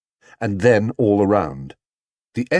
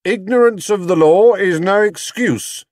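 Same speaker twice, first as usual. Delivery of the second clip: high, loud ominous official voice; loud, foreboding inspector; 11 semitones higher, 5 dB louder than usual. A narrator's reading. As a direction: warn him of the law's weight. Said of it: "said the inspector ominously".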